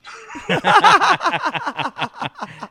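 high-pitched burst of laughter; squealing laugh. Real, not acted. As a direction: crack up at your own line. Laughing at his own quote.

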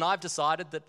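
ordinary talk, nothing marked

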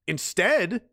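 normal talking voice

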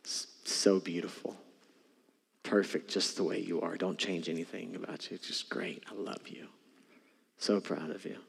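soft, near-whispered loving words; hushed tender affirmation. Shown as a demonstration. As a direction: breathe it softly like a proud father. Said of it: "see him do this to you today".

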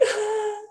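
very high, wailing pretend cry through a laugh; laughing fake crying wail; mock sob. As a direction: let out a hammed-up sobbing wail.

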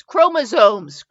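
"Chromosomes" drawn out slowly in a Midwestern accent. Shown as a demonstration. Reviewer shows her Midwestern accent on a word, slowly. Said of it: "I say chromosomes because my Midwestern accent".

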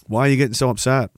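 ordinary talk

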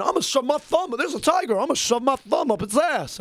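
a light hint of Cartman bravado; Cartman impression. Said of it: "as Cartman would say".

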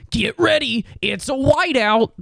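high hyped-up stadium announcer; hype announcer. Retold or imitated. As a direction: pump up the crowd, high. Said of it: "is like".

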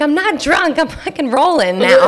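high loud defensive shout; yelling. Retold or imitated. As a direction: shout back with a laugh. Her yelled reply to driver.

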